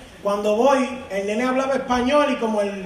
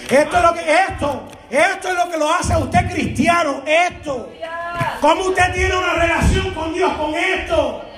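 raised, insistent pulpit voice; forceful preaching; 5 semitones higher than usual. Real, not acted. Preacher challenging Christians who neglect the Bible, forceful preaching. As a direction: hammer it home like a preacher.

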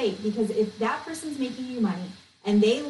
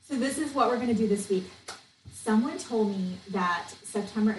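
ordinary talk, nothing marked

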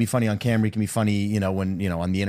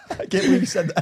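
squeezed out through giggles, high; laughing quote; 9 semitones higher, faster than usual. Retold or imitated. Friend doubled over in the corner, laughing. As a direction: mimic a friend cracking up mid-sentence. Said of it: "just like".

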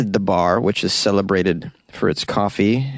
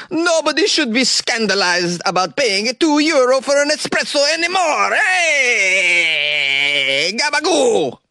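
much higher, hammed-up Italian accent; mock Italian accent; 13 semitones higher than usual. Host quotes the coffee bar owner in a hammed-up Italian accent. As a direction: play a cartoon Italian barista, high up. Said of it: "The owner of this particular coffee bar".

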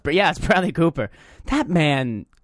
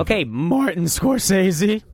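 slow, higher-pitched ribbing; teasing; 4 semitones higher, slower than usual. Real, not acted. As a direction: tease them by stretching out the big name. Teasing friend's movie take.

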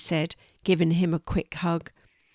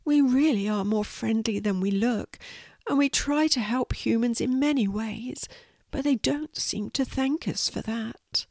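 small voice, growing higher and more forlorn; sad little spider; 5 semitones higher than usual. Acted. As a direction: plead wistfully as a misunderstood tiny creature. Spider pleads his case.

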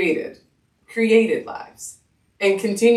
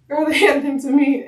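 normal talking voice

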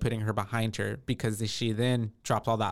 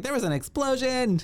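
much higher mock panic on the phone; mock panicked Sue; 13 semitones higher than usual. Host imitates Sue phoning for help, high mock panic. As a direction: squeak it out like a frantic caller. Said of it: "She's like".

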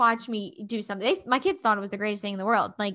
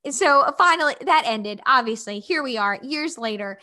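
ordinary delivery

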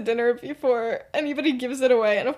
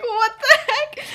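high, slow, laughing disbelief; laughing what the heck; 12 semitones higher, 4 dB louder, slower than usual. Retold or imitated. Friend retelling Lily's baffled reaction, laughing high. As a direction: squeak it out through a laugh, baffled. Lily confused by the prank.

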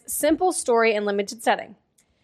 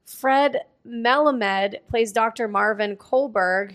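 usual speaking voice

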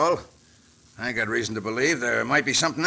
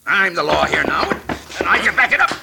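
louder, higher, building to a blow; fighting threat; 7 semitones higher, 5 dB louder than usual. Acted. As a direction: assert your authority, rising into a fight. Sheriff threatens, throws a punch.

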